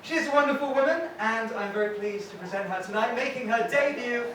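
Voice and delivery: compère introduction. Real, not acted. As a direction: bring her on like an MC. MC introduces next comic.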